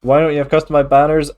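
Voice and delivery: ordinary talk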